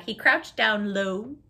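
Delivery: plain reading voice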